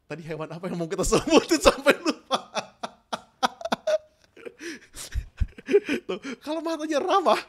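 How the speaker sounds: talking through laughter; laughing. Real, not acted. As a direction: laugh at own slip. Laughs while forgetting.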